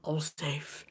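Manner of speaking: hushed whisper; a secretive whispered murmur. A narrator's reading. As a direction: breathe it so no one hears. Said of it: "he murmured the words".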